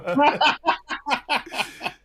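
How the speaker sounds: loud, high burst of laughter; laughing. Real, not acted. Parent amused by the child's millennium jibe, loud real laughter. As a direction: crack up helplessly.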